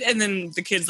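normal talking voice

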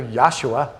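"Yahshua" run together quickly as "Yahsha". Shown as a demonstration. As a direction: clip "Yahshua" short and quick. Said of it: "if we say it faster".

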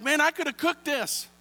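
grumbling customer; exasperated complaint. Retold or imitated. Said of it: "I'm like".

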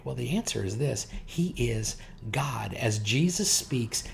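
usual speaking voice

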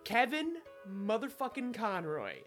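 ordinary delivery

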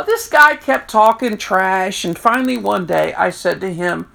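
ordinary talk

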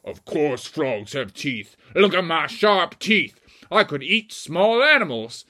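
slow, emphatic creature voice; boastful big frog. Acted. Beelzebufo shows the audience sharp teeth, menacing boast.

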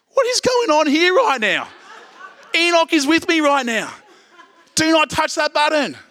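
very high, hammed-up scolding voice; God. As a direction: play an indignant Almighty in a comic high pitch. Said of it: "God comes along and says".

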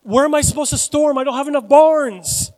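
preacher playing an overwhelmed rich man, loudly; rich farmer's question. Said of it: "So the question he did is".

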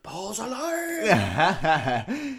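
a mock triumphant cry, high and very slow, laughing; hammy cry. Friend hamming a Flash Gordon moment, laughing. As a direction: stretch it out like a cheesy movie hero.

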